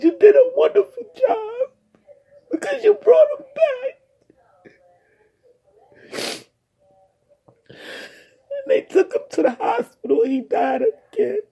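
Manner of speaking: high tearful voice fading to a whisper; real tears, breath catching, trailing off; crying. Real, not acted. Quoting the paramedics' praise while crying softly.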